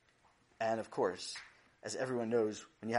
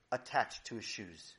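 usual speaking voice

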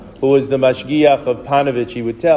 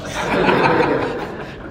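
short real laugh; 3 semitones higher than usual. Real, not acted. Laughs at taxi driver's punchline.

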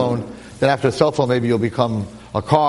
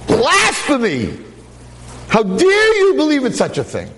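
high, drawn-out thundering indignation; hammed-up, high-pitched outrage; outraged God; 13 semitones higher than usual. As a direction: play an appalled God rebuking a sinner. Acts out God's outrage at blasphemy.

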